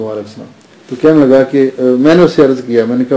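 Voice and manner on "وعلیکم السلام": barely-voiced, much softer answer; a shy young man's hushed reply. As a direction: mumble it back shyly. Shy young man answers greeting.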